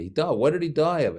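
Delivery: high-pitched imitated shock; taken aback. Doctor learning his old friend died, taken aback. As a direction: react to sudden news, pitched right up.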